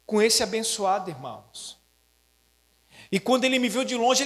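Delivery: ordinary talk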